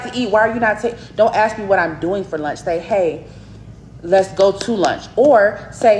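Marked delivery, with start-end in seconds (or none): none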